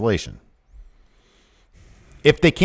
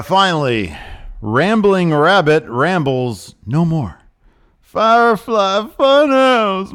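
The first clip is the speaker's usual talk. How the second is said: high, loud, slow showman read; hammed-up hype read; 8 semitones higher, 5 dB louder, slower than usual. Reading the Firefly Funhouse preview, hammed-up announcer. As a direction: ham up the spooky funhouse news.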